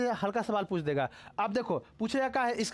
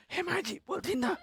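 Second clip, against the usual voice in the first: mimicking a woman; 8 semitones higher than usual. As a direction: squeeze the throat and chirp like a gossiping lady. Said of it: "एकदम गला दाब के बोलेगा". (गला दबाकर महिला की नकल)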